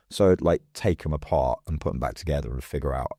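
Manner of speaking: usual speaking voice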